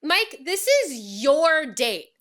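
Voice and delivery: fed-up rebuke, drawn out; exasperated scolding. Real, not acted. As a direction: tell him off like he's in the room. Scolding Mike loudly.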